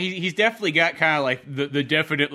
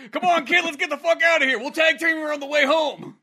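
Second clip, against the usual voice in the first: much higher, louder rogue yelling on the run; mock yell to the kid, pitched way up; hammed-up shout; 11 semitones higher, 5 dB louder than usual. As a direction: bellow it while running out. Voicing Dag fleeing, shouting.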